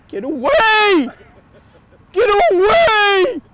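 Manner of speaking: loud, high, drawn-out holler; shouting. Acted. Comedian warding off moose on the highway, shouting. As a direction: yell it slow to scare off an animal.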